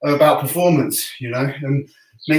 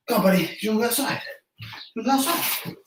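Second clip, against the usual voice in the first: fast, much higher and softer coaxing; dog-talk voice; 7 semitones higher, 6 dB quieter, much faster than usual. Real, not acted. Man coaxing his dog Rocky to go outside, high and fast.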